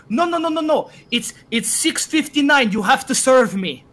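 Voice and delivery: urgent imitation of a demanding customer; insistent customer. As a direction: protest like a pushy late customer. Imitating pushy customer at closing.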